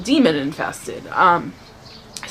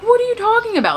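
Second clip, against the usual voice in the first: much higher, stunned cry of disbelief; shocked disbelief; 14 semitones higher, faster than usual. Retold or imitated. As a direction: shoot up in pitch, aghast. Husband says he must leave.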